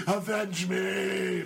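slow ghostly dead grandfather; overacted death scene. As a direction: drag out a ghostly vengeful cry. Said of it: "your dead grandfather, and it's like".

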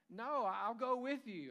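Ruth's vow. Retold or imitated. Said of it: "how do you".

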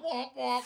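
sad trombone womp womp; mock-sad womp womp sound.

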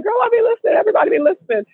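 high, breezy brag; imitating Kaya. Kaya bragging to Morgan, high and breezy. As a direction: toss it off like it's obvious. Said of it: "She was like".

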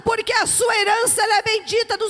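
shouted, high, drawn-out proclamation; full-force preaching. Real, not acted. Preacher declaring a miracle to the congregation, impassioned shouting. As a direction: proclaim it at the top of your voice, stretching each phrase.

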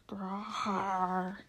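long drawn-out dog growl; imitated beastly growl, held long; animal growl. A narrator's reading. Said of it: "all Uncle Andrew heard was".

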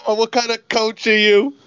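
high-pitched mock heckling; imitating outraged fan. Teasing a friend for yelling at the coach, mock outrage. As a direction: put on your friend's indignant fan voice. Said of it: "You're like".

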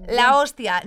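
"La hostia" punched loud on the first O; stress on first O. Shown as a demonstration. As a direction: hit the O in "hostia" hard and loud. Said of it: "con acento en la primera O".

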